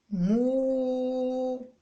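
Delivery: long held مو; drawn-out syllable mu. Shown as a demonstration. Mother modelling the last card, held long. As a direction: stretch out مو. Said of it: "وشكون بقى".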